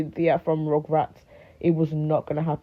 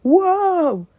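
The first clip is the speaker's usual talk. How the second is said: high, drawn-out crowd whoop; mock crowd cheer; 12 semitones higher than usual. Crowd reacting as the lights come on, mock cheering. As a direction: imitate an overexcited crowd, pitched way up. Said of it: "People are going".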